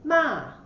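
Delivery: "Ma" starting high and falling sharply; fourth tone. Shown as a demonstration. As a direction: say "Ma" in Mandarin fourth tone, dropping down. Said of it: "The tone goes down".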